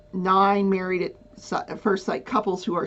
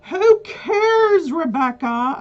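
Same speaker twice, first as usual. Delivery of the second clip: mock viewer; 8 semitones higher, 8 dB louder, slower than usual. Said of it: "You're like".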